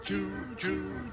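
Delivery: usual speaking voice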